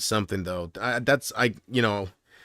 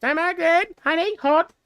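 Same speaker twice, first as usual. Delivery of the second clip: very high, squeaky caricature call; mock cook callout; 4 dB louder, slower than usual. Takeout cook calling the order back to the customer, very high mock voice. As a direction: sing out the order in a tiny high voice.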